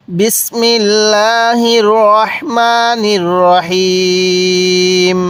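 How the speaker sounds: slow, drawn-out Quranic chanting; measured tajweed recitation. Real, not acted. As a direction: intone it slowly like a reciter. Opening the lesson with bismillah.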